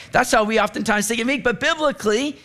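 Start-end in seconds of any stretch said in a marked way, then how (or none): none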